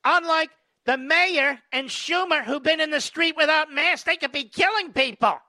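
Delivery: high, hammed-up indignation; mock alarm. Host mocking the reporter's fear of the party, high and sarcastic. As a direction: parody her panic in a high mocking voice.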